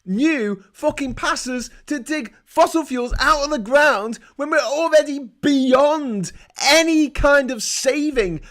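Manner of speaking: loud, high, urgent; furious rant. Real, not acted. Ranting at viewers about oil and children, loud. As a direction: pound each point home. Climate rant at full force.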